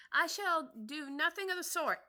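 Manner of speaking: high, loud indignant refusal; indignant mouse. Acted. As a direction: huff and refuse haughtily. Mouse offended, storming off.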